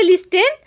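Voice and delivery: "लिस्टन" with an audible T; mispronounced listen. Shown as a demonstration. Teacher rejects the wrong reading, "लिस्टन" with the T pronounced. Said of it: "नहीं पढ़ेंगे".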